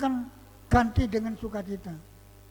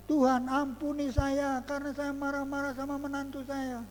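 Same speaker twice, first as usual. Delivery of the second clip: higher, pleading prayer in one long breath; retold prayer; 5 semitones higher than usual. Preacher praying for forgiveness over his in-law, retold prayer. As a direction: retell your own prayer, pitched up. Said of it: "Tapi saya di dalam doa".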